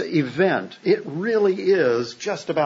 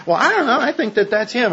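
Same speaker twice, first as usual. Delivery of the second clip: much higher, louder hopeful guess; a high, louder, eager villager's guess; voicing a believer; 10 semitones higher, 5 dB louder, faster than usual. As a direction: pitch it high and eager like a hopeful crowd member.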